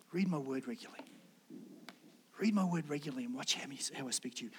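soft, low, hushed voice of God; barely above a whisper, tender. Pretend. God whispering to the listener to read his word, hushed. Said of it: "he whispers".

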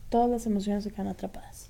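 hushed secret whisper; whispered confidence. Real, not acted. Confiding point about trapped emotions.

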